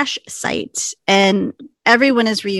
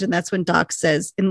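usual speaking voice

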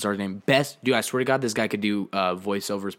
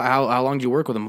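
normal talking voice

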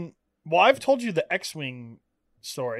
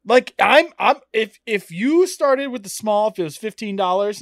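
louder and higher, getting worked up; real heated rant; 5 semitones higher, 6 dB louder than usual. Real, not acted. Complaining to his cohost about prices, heated and loud. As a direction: push it out, annoyed.

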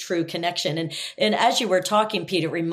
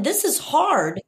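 ordinary talk

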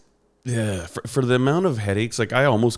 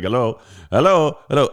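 higher, insistent mock Indian-accent hello; repeated Indian-accented phone greeting; 5 semitones higher, 6 dB louder than usual. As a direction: imitate an Indian-accented caller checking the line. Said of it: "guy with an Indian accent".

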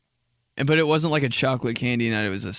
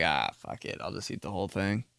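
resigned low mutter; 5 semitones lower, 8 dB quieter than usual. Retold or imitated. Giving in to candy.

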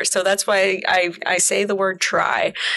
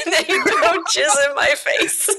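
laughing retelling; 7 semitones higher than usual. Retold or imitated. Retelling scene while laughing.

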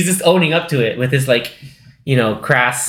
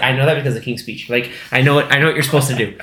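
usual speaking voice

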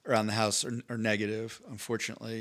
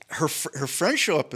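normal talking voice

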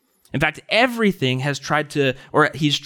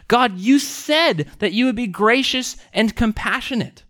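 higher, emphatic plea; crying out to God; 8 semitones higher than usual. Retold or imitated. Psalmist holding God to his promises, crying out. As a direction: plead it insistently, driving each promise home. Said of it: "he's crying out to God saying".

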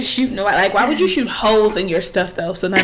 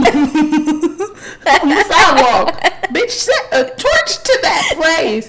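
higher, louder, slow laughing rant; laughing outrage; 7 semitones higher, 4 dB louder, much slower than usual. Real, not acted. Cohost ranting about her sister's caterpillar, laughing and loud.

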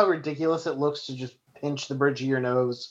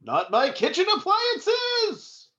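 squeaky panicked voice; slower than usual. Acted. Panicked cry to protect kitchen.

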